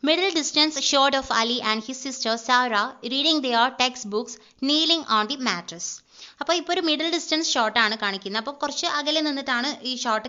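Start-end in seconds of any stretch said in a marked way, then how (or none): 0.1-6.0 reads each word out slowly, like a dictation; slow script reading. Shown as a demonstration. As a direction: spell out the scene calmly for the class. Reading screenplay aloud slowly.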